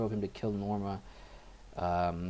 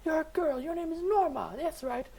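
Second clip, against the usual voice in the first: shrill, much higher mocking mother voice; taunting mother. Mother forcing her son into a dress, high taunting voice. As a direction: taunt him as the cruel mother.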